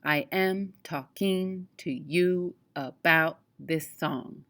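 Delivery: "I am talking to you about this song" slowly, beat by beat; every second syllable hit: AM, KING, YOU, BOUT, SONG; iambic pentameter. Shown as a demonstration. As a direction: scan "I am talking to you about this song" in slow, measured pairs. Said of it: "if I were reading a line of poetry".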